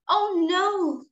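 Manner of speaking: high, drawn-out storybook "oh no"; puffling's high wail of alarm; dismayed cry. Acted. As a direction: cry out in acted dismay, pitch up then falling. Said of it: "cried the puffling".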